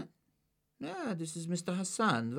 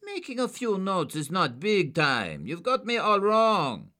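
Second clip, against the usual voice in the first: much higher, slow, loud; desperate protest; 9 dB louder, slower than usual. A narrator's reading. Suspect protesting to agents, desperate. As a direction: protest in a panic.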